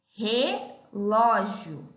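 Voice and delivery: "relógio" very slowly, pausing after re; drawn-out, divided "relógio"; split syllables. Shown as a demonstration. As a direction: say "relógio" as re, pause, lógio. Example word split to show initial R.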